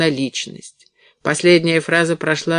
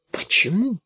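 higher-pitched, doubtful question; incredulous; 4 semitones higher, 3 dB quieter than usual. A narrator's reading. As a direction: ask it in mild disbelief. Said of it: "недоверчиво спросила она".

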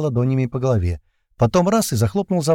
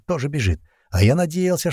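usual speaking voice

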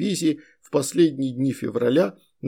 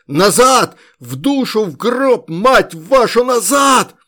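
high loud swearing shout; frantic retreat order; 7 semitones higher, 7 dB louder, slower than usual. A narrator's reading. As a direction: curse and yell them back. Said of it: "В последний раз крикнул Соболь". (отчаянный крик с руганью)

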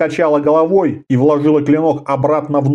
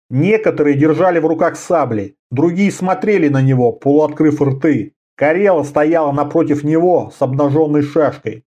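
plain reading voice

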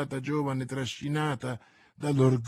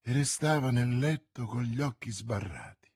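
plain reading voice

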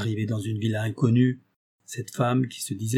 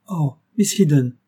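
put-on bright, louder girlish cry of delight; a child cries out happily, higher and louder; joyful cry; 4 semitones higher, 5 dB louder than usual. A narrator's reading. The girl greets Miss Eden with a joyful cry. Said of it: "répondit par un cri joyeux".

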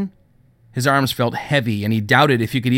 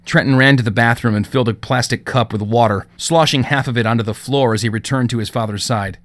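usual speaking voice

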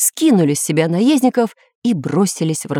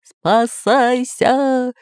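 long, drawn-out high cry; slow, high mock roar of panic; pirate's bellow; 6 semitones higher, much slower than usual. A narrator's reading. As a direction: stretch every syllable into a wail of alarm. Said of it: "ревел пират Бульбуль".

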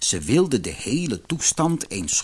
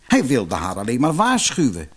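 usual speaking voice

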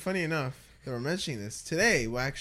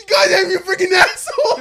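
mock angry yell; 15 dB louder than usual. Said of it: "And then he's like".

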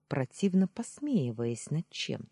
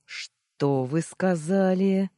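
slow, threatening question, raised and intense; menacing hiss; 5 semitones higher, 6 dB louder, much slower than usual. A narrator's reading. Nephew stepping closer after an insult, menacing hiss. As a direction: hiss it out deliberately. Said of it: "прошипел я тихо".

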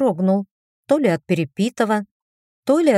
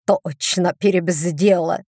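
usual speaking voice

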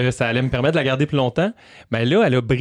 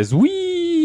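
car whine. Retold or imitated. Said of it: "ça faisait".

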